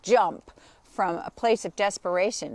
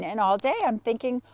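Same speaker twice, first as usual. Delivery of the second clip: ordinary talk, nothing marked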